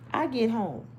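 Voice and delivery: usual speaking voice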